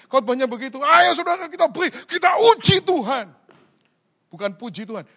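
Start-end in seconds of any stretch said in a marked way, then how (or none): 0.8-3.3 higher, louder hammed-up preacher call; mock preacher. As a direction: mimic a pushy prosperity preacher. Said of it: "pengkhotbah yang khotbahnya begitu". (meniru pengkhotbah yang bersemangat)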